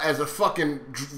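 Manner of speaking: ordinary talk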